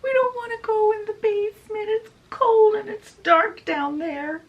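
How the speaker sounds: very high, wailing mock plea; pleading yell. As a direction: cry out like scared girls being dragged off. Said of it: "they're yelling".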